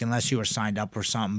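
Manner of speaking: normal talking voice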